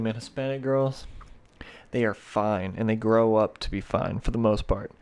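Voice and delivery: ordinary delivery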